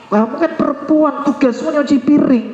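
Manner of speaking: high, put-on voice of a husband ordering his wife around; bossy husband. Husband telling his wife dishes are her job, bossy put-on voice. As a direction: play the entitled husband lecturing her. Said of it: "Nah, laki-laki kayak gitu".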